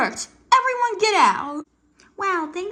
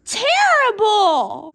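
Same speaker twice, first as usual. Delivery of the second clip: drawn-out complaint; 7 dB louder, much slower than usual. Acted. Complaining about sister's hiccups.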